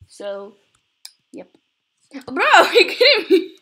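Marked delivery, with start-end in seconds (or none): 2.3-3.5 very loud, high laughing disbelief; laughing outburst. Real, not acted. Gamer yelling at the screen, laughing in disbelief. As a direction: shout it through laughter.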